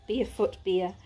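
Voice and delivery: plain reading voice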